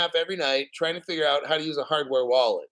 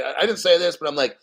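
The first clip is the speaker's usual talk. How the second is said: ordinary delivery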